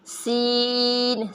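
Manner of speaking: سین held very long, three beats; long seen. Shown as a demonstration. Teacher drawing out سین for the class. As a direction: stretch سین out slowly. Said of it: "اتنا ہی کھینچنا ہے".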